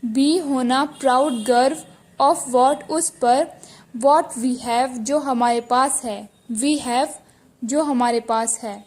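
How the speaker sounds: ordinary talk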